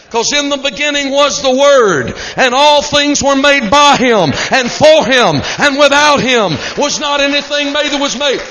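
loud, ringing scripture proclamation; full force preaching. Acted. Preacher proclaiming John's gospel to congregation, loud preaching. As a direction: thunder the verse out to the church.

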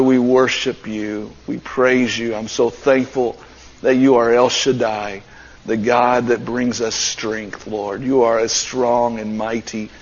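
low, subdued praying voice; prayer. Real, not acted. Pastor leads church in prayer before the sermon, praying.

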